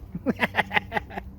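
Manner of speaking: quiet staccato snicker; a little wheezy run of giggles. Real, not acted. Host snickers at his own whispered secret.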